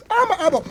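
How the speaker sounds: high, loud cry of disgust; mock pained yelp. Reacting to a gruesome wound mixture, mock pained yelp. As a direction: yelp as if the sight hurts your eye.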